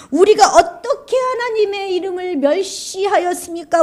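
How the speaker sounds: high, put-on voice of protest; indignant denial. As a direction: deny it all, offended. Israelites deny wrongdoing.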